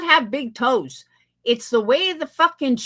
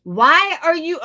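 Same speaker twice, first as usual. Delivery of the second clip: louder, sharp start of a question; loud outburst; 5 semitones higher, 6 dB louder than usual. Real, not acted. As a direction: fire the question out loudly. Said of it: "okay, here we go".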